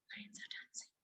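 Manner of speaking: soft breathy whisper aside. A narrator's reading. Storyteller telling children about the lions, whispered. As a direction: whisper it like a secret.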